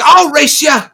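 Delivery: high, loud dwarf challenge; changeable dwarf voice. Acted. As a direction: throw down a challenge in a hammed-up dwarf voice. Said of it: "He's just gonna keep changing".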